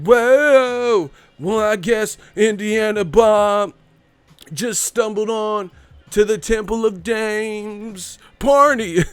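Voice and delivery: high, slow goofy Bob voice; Bob from Martin. Retold or imitated. Impersonating Bob from Martin, high and goofy. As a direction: play the clueless square at a party. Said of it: "Remember Bob from Martin".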